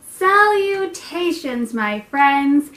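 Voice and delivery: hammed-up grand welcome; weird announcer voice. Shown as a demonstration. Host greeting her viewers in an odd showy voice. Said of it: "I'm talking so weird".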